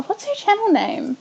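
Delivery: usual speaking voice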